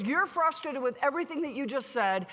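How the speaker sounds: ordinary talk, nothing marked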